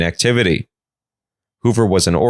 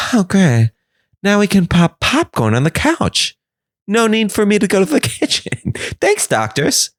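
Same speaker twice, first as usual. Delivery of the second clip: chirpy sister; 11 semitones higher than usual. Acted. Sister replies cheerfully.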